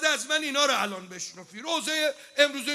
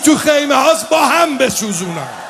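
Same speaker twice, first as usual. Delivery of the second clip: Shimr shouting orders; 6 semitones higher, 13 dB louder than usual. Acted. Preacher voicing Shimr threatening to burn everyone, loud shouted command. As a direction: roar it, stretching each word. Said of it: "گفت".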